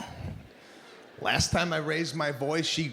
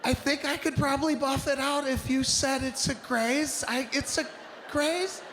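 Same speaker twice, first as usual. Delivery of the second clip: much higher, strained, barely holding it; forced calm; 9 semitones higher than usual. Acted. Husband sarcastically questioning wife's graze, strained high. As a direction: keep the rage bottled, voice squeezed up high. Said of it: "I don't even sound like me".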